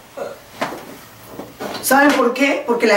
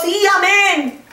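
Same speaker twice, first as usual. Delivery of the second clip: eager amen; 8 semitones higher, slower than usual. Retold or imitated. Acted congregation answering.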